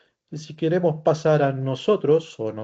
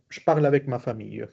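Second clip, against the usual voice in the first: fast, joined-up French sentence; quick fluent repeat; faster than usual. Shown as a demonstration. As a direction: run the words together at native speed. Same sentence repeated at fluent speed.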